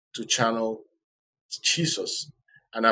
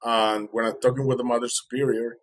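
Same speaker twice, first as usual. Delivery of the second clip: usual speaking voice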